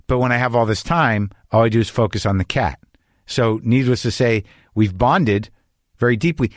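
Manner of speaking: ordinary talk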